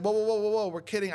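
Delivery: hurried backpedalling, retold; trying to defuse. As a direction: placate an angry stranger. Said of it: "I tried to diffuse".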